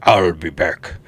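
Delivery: put-on low Austrian accent, Arnold style; deep Austrian Terminator line; Schwarzenegger impression. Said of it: "he sounded just like".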